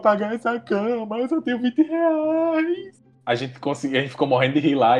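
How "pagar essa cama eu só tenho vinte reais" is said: high, panicked, slowing plea; desperate. Retold or imitated. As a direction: beg in a higher panicked voice. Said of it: "E eu desesperado".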